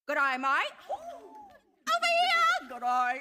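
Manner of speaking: a light hammed-up Australian accent on "Good eye, mate"; a playful Aussie put-on, loud with a laugh breaking in. [name] shows her Australian disguise, mock Australian accent. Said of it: "I will sport an Australian accent".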